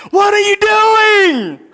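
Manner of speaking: high, slow, anguished shout; David yelling at God. Retold or imitated. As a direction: cry out to God in frustration. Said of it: "you can almost hear David yelling at God".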